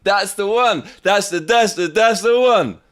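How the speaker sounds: high, loud delight; thrilled. Real, not acted. Thrilled by his new track.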